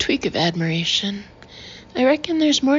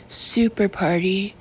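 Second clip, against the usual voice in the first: usual speaking voice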